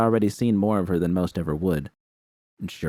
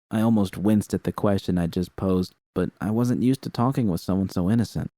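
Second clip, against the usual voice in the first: usual speaking voice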